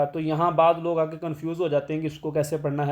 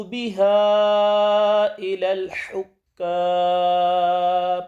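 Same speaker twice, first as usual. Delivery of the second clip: slow melodic tilawat, higher; solemn recited verse; Quran recitation; 5 semitones higher, 4 dB louder, much slower than usual. Acted. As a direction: chant the verse slowly and carefully.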